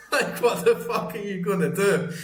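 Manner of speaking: high-pitched words squeezed through laughter; laughing while talking. Real, not acted. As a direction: say it while cracking up. Talks through own laughter.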